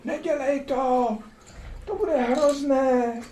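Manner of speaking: high, slow mock-pleading friend; worried friends. Friends warning the man not to do it, high slow plea. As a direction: plead with him like a frightened friend, drawn out. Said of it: "přátelé, říkají".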